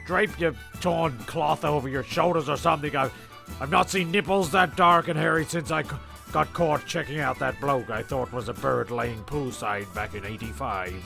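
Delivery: normal talking voice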